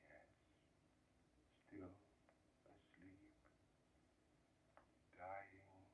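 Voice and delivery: dying man's almost inaudible reply; very faint, almost inaudible. Acted. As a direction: let the words barely escape. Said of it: "he said very faintly, almost inaudibly".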